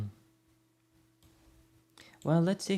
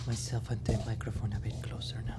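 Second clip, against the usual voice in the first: whispering; 4 dB quieter than usual. Real, not acted. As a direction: close hushed whisper. Whispering near microphone.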